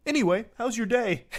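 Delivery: imitating an abrupt friendly reset; sudden de-aggro. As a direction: switch to breezy chit-chat, amused. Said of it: "de-aggroing like an N P C in a video game".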